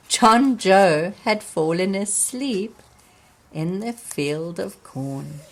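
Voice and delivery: ordinary delivery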